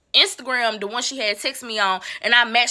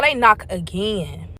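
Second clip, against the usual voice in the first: usual speaking voice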